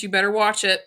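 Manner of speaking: ordinary delivery, nothing marked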